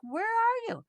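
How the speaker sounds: high, loud mock-annoyed "where are you"; a wife snapping down the phone, replayed; retold exasperated call. Calling husband who forgot soda.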